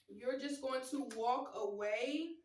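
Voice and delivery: ordinary talk, nothing marked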